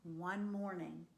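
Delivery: plain reading voice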